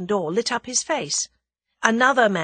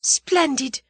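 put-on high exclamation in a girl's voice; delighted cry; 9 semitones higher, 4 dB louder than usual. A narrator's reading. The girl admires Julius, crying out. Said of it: "cried the girl".

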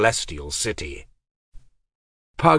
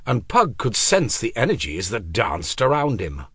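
usual speaking voice

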